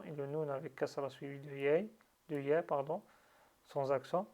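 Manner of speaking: ordinary delivery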